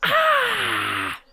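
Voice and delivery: high, loud imitated screaming crow. Shown as a demonstration. Imitating crows that sound like a person screaming, shriek. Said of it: "sounds like someone is screaming".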